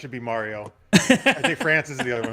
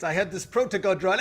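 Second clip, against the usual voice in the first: ordinary talk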